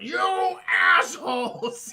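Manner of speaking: drawn-out, loud mock yell; mock upset shout. Alien walking out of crashed ship, mock upset shout. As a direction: stretch each word in hammed-up outrage. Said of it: "He's so upset".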